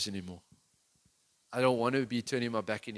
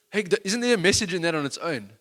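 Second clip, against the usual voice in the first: ordinary talk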